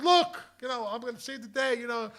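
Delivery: high-pitched mock pleading; desperate reassurance. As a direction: try to win them over, voice way up. Acted plea to save the day.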